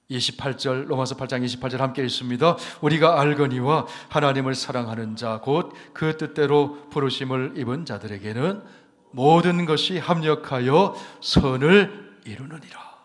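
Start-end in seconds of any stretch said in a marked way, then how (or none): 2.8-12.9 loud, ringing Bible reading; 1 scripture reading. Acted. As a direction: proclaim the verse to the whole hall. Reads scripture aloud to congregation. (큰 소리로 성경 봉독)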